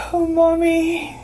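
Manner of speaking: put-on slow, drowsy girl voice; half asleep. A narrator's reading. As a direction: murmur it between sleep and yawn. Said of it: "between sleep and yawn".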